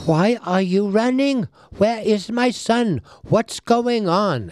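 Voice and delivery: high, anxious mother's questions; worried mother. Acted. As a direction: plead for answers like a frantic mother.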